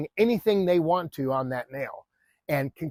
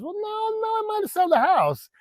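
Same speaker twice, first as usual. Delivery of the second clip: very high, put-on wail of refusal; protesting Italian homeowner. Homeowner wavering at a bigger offer, high mock protest. Acted haggling, owner wavers.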